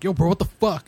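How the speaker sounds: shouted, high and rough; angry dude. Retold or imitated. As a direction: yell it like an angry stranger. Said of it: "some dude's like".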